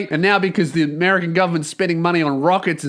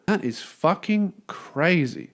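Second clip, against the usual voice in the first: ordinary delivery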